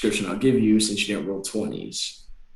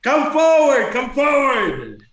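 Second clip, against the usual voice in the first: high, loud, drawn-out command; shouting redcap; 12 semitones higher, 6 dB louder, slower than usual. Acted. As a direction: bellow the challenge in a high creature voice. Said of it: "shut it and go".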